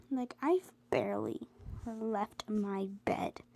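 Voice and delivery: ordinary talk, nothing marked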